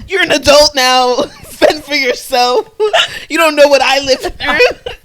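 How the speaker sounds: high, very loud, laughing shouting; mock rant. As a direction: bellow a hammed-up hardship speech, cracking up.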